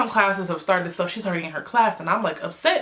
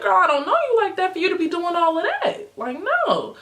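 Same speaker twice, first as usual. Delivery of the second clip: much higher, loud indignant sass; outraged sass; 11 semitones higher, 3 dB louder than usual. Retold or imitated. Telling off a girl who wiped her hand on her, indignant. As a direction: push her away with attitude.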